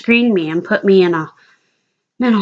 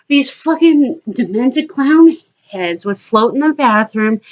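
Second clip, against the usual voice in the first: normal talking voice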